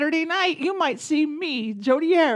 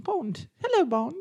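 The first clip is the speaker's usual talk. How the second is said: higher, soft Moneypenny greeting; put-on British accent; 4 semitones higher, 6 dB quieter than usual. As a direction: attempt British as Moneypenny, lightly amused. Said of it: "We both have to work on our accents".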